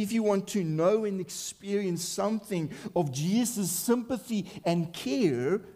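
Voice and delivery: normal talking voice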